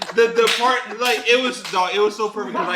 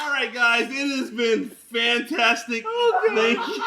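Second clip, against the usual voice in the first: mock polite farewell; 7 semitones higher than usual. Man escaping the meeting thanks everyone, overly polite. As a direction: wrap up with overdone politeness. Acting his hasty polite exit.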